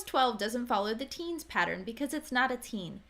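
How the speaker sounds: ordinary talk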